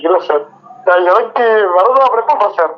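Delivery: ordinary talk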